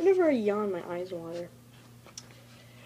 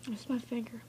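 normal talking voice

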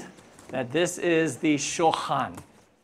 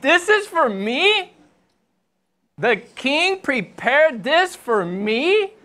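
loud, high, slow rising questions; overwhelmed, drawn-out incredulity; amazed disbelief; 8 semitones higher, 6 dB louder, slower than usual. Acted. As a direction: stretch it out, astounded at the honor. Acted amazement at the king's feast.

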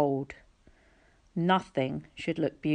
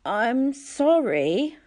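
high, slow, drowsy mumble; barely awake, sorry; weak mumbled apology; 7 semitones higher, 3 dB louder, much slower than usual. Acted. Dazed boy apologising to the girl, mumbled high and slow. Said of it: "I mumbled".

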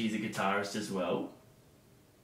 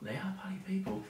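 soft whisper, echoing the co-host; 7 semitones higher, 9 dB quieter than usual. Pretend. Host agreeing about the party people, whispered.

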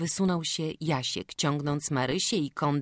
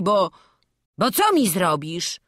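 hammed-up gruff threat, barely holding a laugh; mock angry deep voice; 4 semitones higher, 9 dB louder than usual. Boy pretending to threaten his friend, mock-gruff. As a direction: pretend fury in a put-on gruff voice. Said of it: "krzyknął Wawrzuś grubym głosem, niby srodze rozsierdzony".

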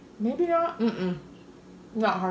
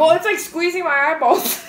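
laughing; 7 semitones higher than usual. Real, not acted. Blindfold taste test, laughing.

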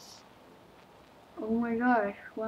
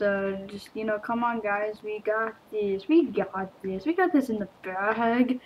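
ordinary talk

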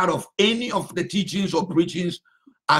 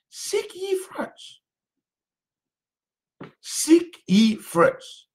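slow repeated emphasis; 11 semitones higher, much slower than usual. Acted. Preacher repeats key verse.

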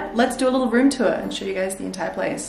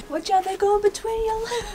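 cutesy pet voice; 11 semitones higher, 4 dB quieter than usual. Real, not acted. Talks to the cats.

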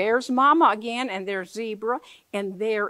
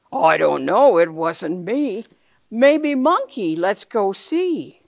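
Hippo's voice; 6 dB louder, slower than usual. Acted. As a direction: boom it slowly like a big hippo. Hippo answers in book.

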